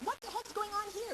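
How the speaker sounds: high-pitched mock outburst; rude demand. Shown as a demonstration. How not to talk to a cooking partner, rude outburst. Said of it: "don't talk like that to your partner".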